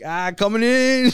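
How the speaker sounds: high, loud, drawn-out call; hammed-up shout while leaping in; mock warning holler. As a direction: call it out slowly to those below. Acting out jumper above playing kids.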